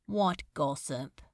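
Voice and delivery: flat, beaten and obedient; resigned, lifeless reply. A narrator's reading. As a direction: say it with no life left. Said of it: "he said obediently, voice lifeless".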